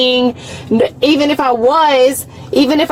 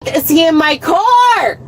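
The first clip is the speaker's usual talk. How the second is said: angry yell; 3 semitones higher than usual. Real, not acted. Detained driver protesting someone in the car, yelling. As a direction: burst out in real outrage.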